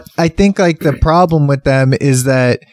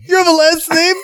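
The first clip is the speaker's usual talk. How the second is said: high mock disbelief; 13 semitones higher than usual. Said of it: "It's like".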